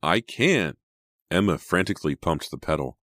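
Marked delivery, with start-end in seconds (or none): 0.0-0.8 high, louder protest; panicked reply. A narrator's reading. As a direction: cry out helplessly. Driver panics, brakes failing.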